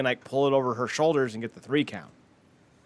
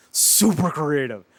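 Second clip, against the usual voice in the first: slow, louder deadpan sarcasm; sarcastic; 5 dB louder, slower than usual. Real, not acted. Host mocks WWE's lazy feud booking, slow sarcastic drawl. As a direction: drag it out, dripping with sarcasm.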